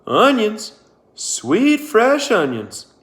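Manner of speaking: high, loud, drawn-out vendor cry; a far-off onion seller's long call. Acted. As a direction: sing it out like a street seller hawking onions. Sam's vendor call across emptiness.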